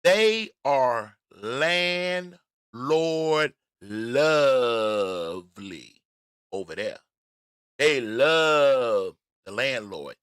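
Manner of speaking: very slow, stretched words; slow, weighty pronouncement; drawn-out emphasis. Real, not acted. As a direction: stretch each word out for effect, loudly. Drawing out a pointed claim.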